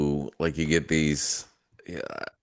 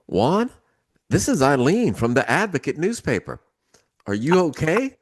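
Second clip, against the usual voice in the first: alarmed caller; 11 semitones higher, 6 dB louder than usual. Retold or imitated. Alarmed reporter on phone retold.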